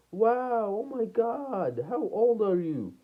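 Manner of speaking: high-pitched, stretched-out mock awe; imitated amazed girl. Girl amazed at the young car owner, high imitated awe. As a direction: marvel in a put-on high girl's voice. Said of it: "she was like".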